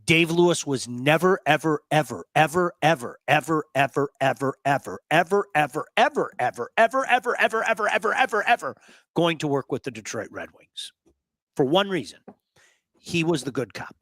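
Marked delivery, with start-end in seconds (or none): 0.0-10.9 a long emphatic chain of ever, ever, ever; endless evers. Real, not acted. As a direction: pile on every ever to hammer the point home. Emphatic rant, endless repeated evers.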